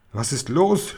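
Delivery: ordinary delivery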